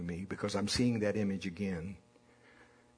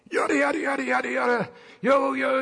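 much higher and louder, a shouting stranger; yelling for attention; 14 semitones higher, 9 dB louder than usual. Retold or imitated. Preacher imitates a teen yelling at sheep. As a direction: holler the nonsense at the sheep. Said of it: "trying to get the attention of the sheep".

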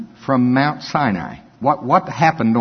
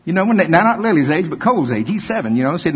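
ordinary talk, nothing marked

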